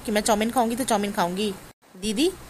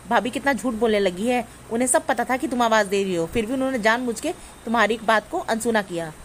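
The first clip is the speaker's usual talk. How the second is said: normal talking voice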